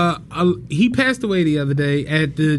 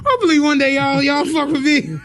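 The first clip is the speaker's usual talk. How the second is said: mock crying plea; 8 semitones higher than usual. Mocking Trump pleading.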